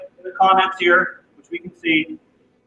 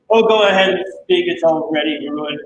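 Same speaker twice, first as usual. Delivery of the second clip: ordinary talk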